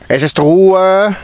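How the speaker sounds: high, loud and slow, stretching Ruhe; a stern teacher silencing the room; booming call for silence. A narrator's reading. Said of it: "schallte es von der Frau mit der Brille durch den Raum".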